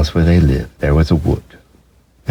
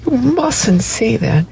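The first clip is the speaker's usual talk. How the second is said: put-on much higher child's voice, slow and earnest; high earnest child, building at "He's real"; little boy insisting; slower than usual. A narrator's reading. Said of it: "he said".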